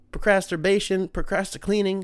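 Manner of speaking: usual speaking voice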